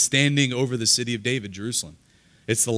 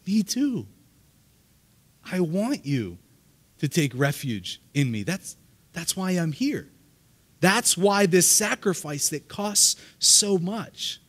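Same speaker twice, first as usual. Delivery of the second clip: voicing Jesus higher, with yearning; Jesus pleading; 6 semitones higher, slower than usual. Preacher voicing Jesus over the people: higher pleading. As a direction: plead like Jesus longing to shelter them. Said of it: "here's Jesus over the people saying".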